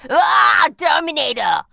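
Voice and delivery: shouted Terminator in a hammed-up Austrian accent; mock Arnold accent. Shown as a demonstration. Guest shows how to buzz in, hammed-up Austrian accent. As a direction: bark Terminator like a bad Arnold impression. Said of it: "answer in an Arnold accent and go".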